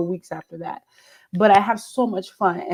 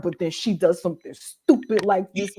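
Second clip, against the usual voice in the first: ordinary delivery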